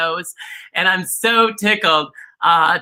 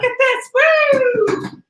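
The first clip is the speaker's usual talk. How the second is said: excited squeal; much slower than usual. Acted. Showing off oiled chair.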